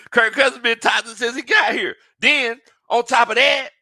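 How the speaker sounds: loud high shout. Real, not acted. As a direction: shout the accusation. Shouting the rant.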